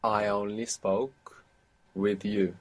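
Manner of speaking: slow, each word set apart; teacher to learner, slowly keeping words apart; separate the words. Shown as a demonstration. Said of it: "Don't let it blend together".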